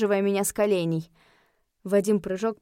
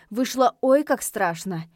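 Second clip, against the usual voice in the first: ordinary delivery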